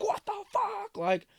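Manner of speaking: imitating mom freaking out in falsetto; hysterical mom. Imitating mom panicking while the neighbors stroll over.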